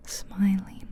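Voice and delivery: ordinary delivery, nothing marked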